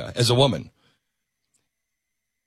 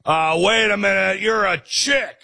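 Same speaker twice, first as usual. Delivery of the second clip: mock official; 6 semitones higher than usual. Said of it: "Nobody said".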